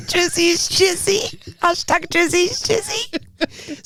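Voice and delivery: high, slow, laughing chant. Real, not acted. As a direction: giggle it out slowly. Laughing, repeating the hashtag.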